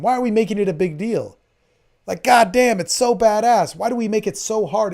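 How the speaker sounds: real fired-up enthusiasm; excited. Real, not acted. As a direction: let the excitement burst out. Excited outburst about sex.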